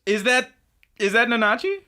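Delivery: high, loud questioning; real surprise. Real, not acted. Host to co-host on hearing who sings, astonished. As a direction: blurt it out, rising in disbelief. Startled question about singer.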